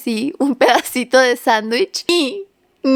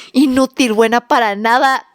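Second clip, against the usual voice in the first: mock insults; slower than usual. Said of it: "No sé qué creyeron que yo le dije".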